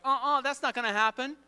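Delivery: mock indignant denial; protesting disciples.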